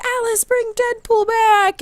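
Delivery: very high, slow drawn-out plea; mock group pleading. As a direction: imitate a crowd begging in falsetto. Everyone calls on her to cast.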